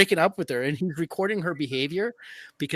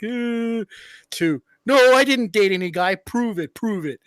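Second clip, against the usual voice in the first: mock defiant; 6 semitones higher, 8 dB louder than usual. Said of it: "her tone changes".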